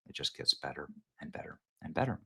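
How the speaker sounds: whispered, fast, savoring the news; gleeful whisper. Real, not acted. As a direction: let it slip out in a quick delighted whisper. Gloating aside to viewers.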